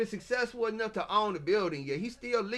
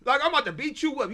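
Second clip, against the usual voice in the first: fast, loud, higher threat; threatening the lawyer, fast and loud; heated threat; 8 semitones higher, 7 dB louder, faster than usual. Real, not acted. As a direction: blurt it out fired up.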